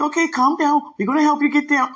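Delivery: imitating people calming her down; soothing helpers. Helpers calming her down on the way down, high mock soothing voice. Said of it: "They be like".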